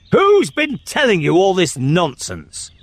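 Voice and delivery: high-pitched, angry royal demand; thundering. Acted. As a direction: thunder at the child. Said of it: "in a voice of thunder".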